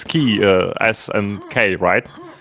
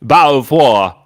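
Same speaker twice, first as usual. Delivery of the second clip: a louder echo in a put-on British accent; mock British accent; 3 semitones higher, 5 dB louder than usual. Friend copies the British demo in mock English. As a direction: parrot the English bottle of water. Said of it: "That is a proper pronunciation, mate".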